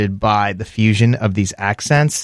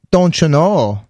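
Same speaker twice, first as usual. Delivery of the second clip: higher, imitated Minnesota lilt; Minnesota accent; 4 semitones higher, slower than usual. Host showing the Minnesota catchphrase, imitated accent. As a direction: put on a Minnesota accent, long O. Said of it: "there's the Minnesota accents".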